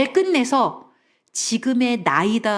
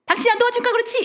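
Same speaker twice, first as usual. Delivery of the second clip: very high mock whine of blame; defensive retort; 13 semitones higher, much faster than usual. Wife answering husband's blame, high-pitched defensive retort. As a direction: imitate a wife snapping back. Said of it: "아내가".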